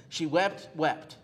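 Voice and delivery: "wept" said twice, the first rising, the second falling; doubled word stressed. Shown as a demonstration. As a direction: repeat "wept" for emphasis. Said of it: "it literally says".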